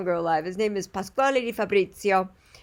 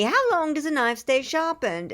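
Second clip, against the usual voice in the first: higher-pitched British imitation; British accent; 7 semitones higher than usual. Knife sharpener repeating what people ask him, British accent. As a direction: mimic an English tradesman, pitched up. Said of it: "I think he's English".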